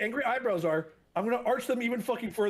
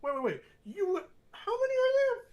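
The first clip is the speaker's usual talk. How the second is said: shocked; 13 semitones higher than usual. Pretend. Shocked at glass pile.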